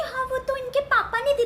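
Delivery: mimicking relative. Aunt imitates other woman.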